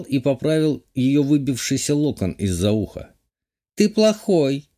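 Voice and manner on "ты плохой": put-on high pouty girl voice; mock pouting. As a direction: sulk it out, higher. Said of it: "деланно нахмурилась Кати".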